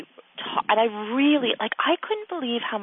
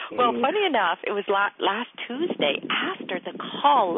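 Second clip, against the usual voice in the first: ordinary delivery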